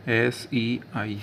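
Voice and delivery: एस ई आई spelt slowly; slow dictation; slow spelling. Shown as a demonstration. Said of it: "सेई".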